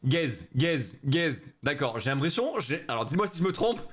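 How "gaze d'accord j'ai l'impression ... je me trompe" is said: ordinary talk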